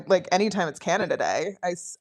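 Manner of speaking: ordinary talk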